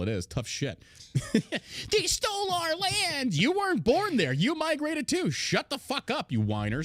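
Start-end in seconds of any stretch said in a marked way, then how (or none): 1.1-1.8 breathy mock laugh. Mocking complainers. 1.9-6.3 much higher, slow whiny mockery; high nasal-free sneering whine; mocking whiner voice.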